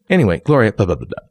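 ordinary talk, nothing marked

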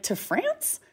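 high, incredulous question; can't believe it's France; replaying her stunned reaction at check-in; shocked disbelief. Shocked at wrong booking.

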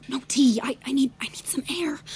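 breathless hushed whisper. Acted. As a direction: whisper it, close to panic. Sneaking out, whispering to the maid.

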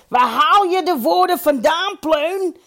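high, loud heckle; calling out. A narrator's reading. Dolf Hovie heckles the chairman mid-speech, calling out high. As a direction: shout it across the hall. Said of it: "riep Dolf Hovie".

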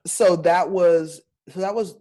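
ordinary talk

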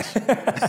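short high real laugh; burst of laughter. Real, not acted. After the worry tree punchline, a real laugh. Laughs at his own story.

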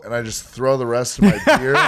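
normal talking voice

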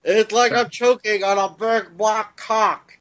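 loud high mock voice; high put-on voice. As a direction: squeal it out in a silly high voice. Crude voiced bit in chat.

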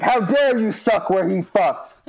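hammed-up furious Harry Potter line; aggressive. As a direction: bark it like a furious wizard. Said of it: "get really aggressive".

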